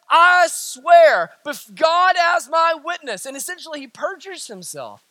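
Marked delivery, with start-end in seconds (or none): 0.1-3.2 raised, forceful swearing; emphatic oath. Acted. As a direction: swear it insistently in a higher voice. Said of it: "Peter's denial is more emphatic".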